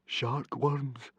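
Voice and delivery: soft, scared gulp; gulping in fear. Acted. Frightened boy gulping at the teacher's warning. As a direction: choke out the words in dread. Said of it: "gulped Fishlegs".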